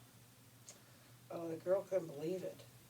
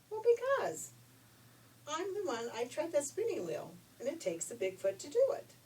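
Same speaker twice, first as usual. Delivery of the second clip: a high, lifted voice for the big-footed auntie, higher at the start; 8 semitones higher, 3 dB louder than usual. Acted. Auntie answers the prince about her foot, high voice. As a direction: lift way up for the proud old spinner.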